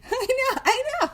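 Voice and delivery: very high giggly admission; squeaky laughing. Real, not acted. Laughing at own joke.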